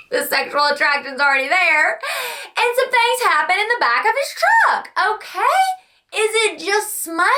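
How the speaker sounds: high, squeaky laughing delivery; laughing through words. Real, not acted. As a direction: giggle out the spicy confession. Laughing about spicy scene.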